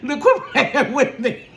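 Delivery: loud, high, slow, laughing; laughing scold. Real, not acted. Mom mock-scolding her son, laughing loudly.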